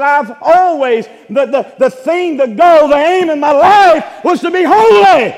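loud, high, slow fervent cry; fervent proclamation. Retold or imitated. Dying preacher telling gathered friends, loud slow fervent cry. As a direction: proclaim life's aim with fire.

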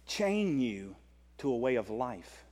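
ordinary delivery

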